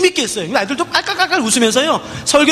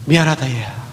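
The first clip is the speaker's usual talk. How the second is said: much lower, hushed sorry; low, remorseful apology; 9 semitones lower than usual. Retold or imitated. Preacher on his knees apologises to the girl, low and remorseful.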